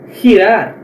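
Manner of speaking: girar with a rough h-like g; Spanish j sound. Shown as a demonstration. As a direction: girar starting with a throaty x sound. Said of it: "como se fosse o R dobrado". (girar com som de R dobrado)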